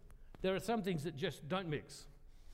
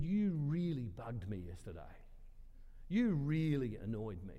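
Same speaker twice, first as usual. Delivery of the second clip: retold in a lower, deadpan voice; stone-faced serious; 6 semitones lower than usual. Woman retelling her annoyance to the pastor, stone-faced serious. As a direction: imitate her grave, measured complaint. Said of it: "she's stone-faced serious the whole time".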